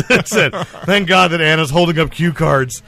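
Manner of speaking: loud, laughing joke line. Real, not acted. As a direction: crack up while saying it.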